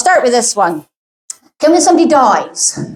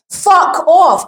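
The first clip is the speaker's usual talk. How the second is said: exasperated outburst; 7 semitones higher than usual. Real, not acted. Dismissing doctors at death.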